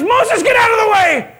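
high, furious mock shout; hammed-up wrathful yell; God. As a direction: holler as if about to wipe them all out. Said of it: "And God says".

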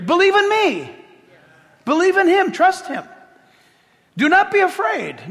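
high, loud pleading call; earnest plea. Retold or imitated. Preacher voicing Jesus urging the crowd to believe. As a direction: urge them to trust. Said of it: "Jesus is gonna make it clear".

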